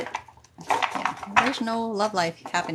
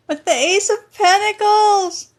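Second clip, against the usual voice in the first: high, loud surprised outburst; surprised shout; 12 semitones higher, 10 dB louder, slower than usual. Real, not acted. Reacting to the tarot card drawn, loud surprised shout. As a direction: blurt it out, startled by the card.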